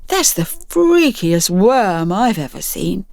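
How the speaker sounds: slow, wary wonder; hushed awe. Acted. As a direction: stare at it, spooked. Clyde awed by the worm.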